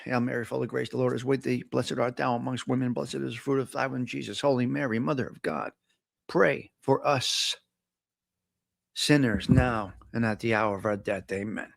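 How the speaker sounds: soft, reverent recited prayer. Acted. Host leading listeners in a Hail Mary, soft prayer. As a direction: pray it quietly and steadily.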